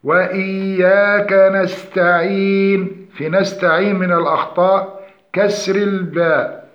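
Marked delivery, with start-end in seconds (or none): 0.0-3.1 slow, measured tajweed recitation; Quran recitation. Shown as a demonstration. Teacher models the verse for students, slow recitation. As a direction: draw out the long vowels, chanting evenly.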